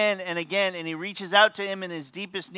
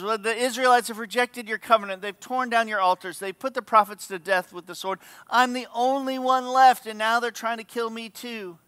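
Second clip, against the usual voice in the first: higher-pitched, self-pitying complaint; a little whiny; 4 semitones higher than usual. Acted. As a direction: imitate an aggrieved whiner. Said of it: "a little whiny voiced when he's talking to God".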